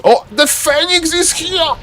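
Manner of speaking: high, stretched-out cry of excitement; excited shout. Real, not acted. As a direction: shout it high as a thrilled commentator. Commentator spots the Phoenix appearing.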